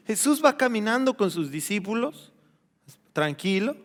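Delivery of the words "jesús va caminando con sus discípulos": usual speaking voice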